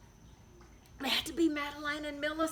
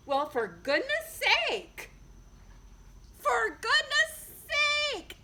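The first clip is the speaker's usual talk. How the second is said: exasperated big sisters; 12 semitones higher, 5 dB louder than usual. A narrator's reading. Big sisters coming in from skating, exasperated. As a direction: huff it out like a fed-up sister. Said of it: "they said".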